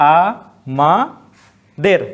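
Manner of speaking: আ, মা, দের split into single syllables with long pauses; slow syllable count. Shown as a demonstration. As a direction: break আমাদের into its syllables, stopping on each. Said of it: "এই দেরটাতে আমরা থেমে যেতে বাধ্য হচ্ছি".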